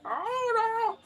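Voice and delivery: very high squeaky "oh no"; mock old lady gasp. Old lady reacts after hitting bike.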